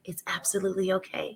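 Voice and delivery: soft reassuring whisper to a parent; hushed voiced-off reassurance to a worried mother. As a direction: breathe it gently, no voice.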